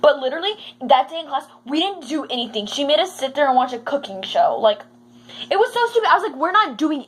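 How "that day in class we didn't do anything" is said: normal talking voice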